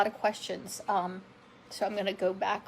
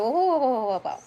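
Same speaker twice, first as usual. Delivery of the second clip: stunned whoa; 3 semitones higher, 9 dB louder than usual. Retold or imitated. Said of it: "And I said".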